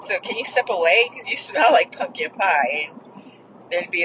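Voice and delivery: ordinary delivery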